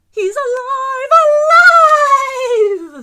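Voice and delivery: shrieking mad-scientist cry, very high and loud; exclaimed. Acted. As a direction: sing out the triumph of creation. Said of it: "the little terrors exclaimed".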